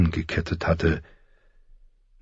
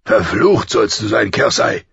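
loud, higher, scornful curse; contemptuous curse; 5 semitones higher, 6 dB louder than usual. A narrator's reading. King cursing his wife, full of contempt. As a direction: spit it out with open contempt. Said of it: "sagte er voller Verachtung".

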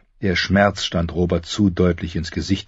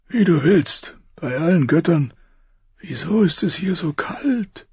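high, strained voice of a dying king; 12 semitones higher than usual. Acted. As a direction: push each word out through pain. Robert speaks.

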